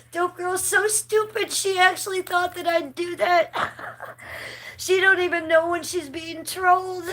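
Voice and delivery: high giggly mockery; mock her while still laughing. Real, not acted. Mocking troll through laughter.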